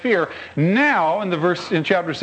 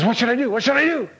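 higher, anxious pleading; a lightly voiced panicky husband; desperate asking; 6 semitones higher, faster than usual. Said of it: "he comes to me and says".

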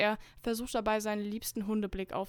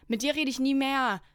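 loud, higher-pitched furious shout at the door; angry snapping; 3 semitones higher, 7 dB louder than usual. A narrator's reading. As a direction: yell it to chase him away. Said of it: "schnauze ich in Richtung Tür". (zornig angeschnauzt)

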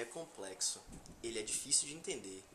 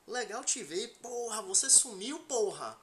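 excited greeting; 8 semitones higher, slower than usual. Shown as a demonstration. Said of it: "Se você vê um amigo".

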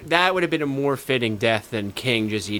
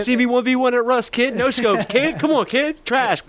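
gamer trash talk; 9 semitones higher than usual. Acted. Mocking a gamer calling out a rival. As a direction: jeer like a squeaky voice-chat kid.

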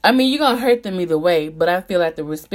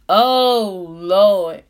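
exasperated; 6 dB louder than usual. Real, not acted. Exasperated exclamation.

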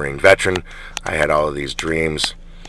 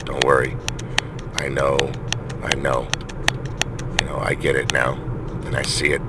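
softer, gentle and soothing; gentle coaxing; 3 semitones lower, 7 dB quieter than usual. Retold or imitated. Father soothing his scared daughter after spilled milk, soft and gentle. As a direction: coax her softly, no blame. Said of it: "I was gentle with her, coaxing with her".

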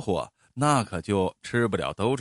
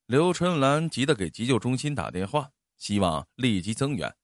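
usual speaking voice

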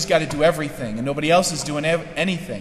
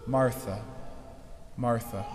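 soft, tender calling of her name, softer; gentle; 6 semitones lower, 10 dB quieter than usual. Retold or imitated. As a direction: call her name gently, like comforting a friend. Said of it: "the gentleness in the voice of Jesus".

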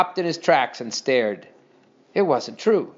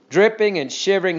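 ordinary delivery, nothing marked

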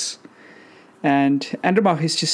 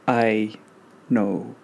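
low telepathic voice; 6 semitones lower than usual. Acted. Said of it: "a mental message coming back".

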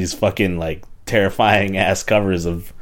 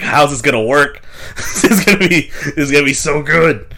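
giddy, higher and bright; excited anticipation; 4 semitones higher than usual. Retold or imitated. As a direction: gush with excitement. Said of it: "And I was like".

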